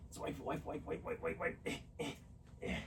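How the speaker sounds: soft whisper, coaxing; whispered wiping. Real, not acted. Owner drying the wet pet with a towel, soft whispering. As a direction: murmur to the pet while drying her.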